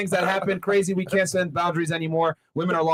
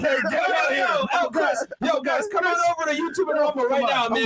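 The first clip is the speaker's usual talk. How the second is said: high-pitched, hurried call to the audience; urgent calling out; 8 semitones higher than usual. Real, not acted. Host sending viewers over to YouTube and Rumble, high urgent call.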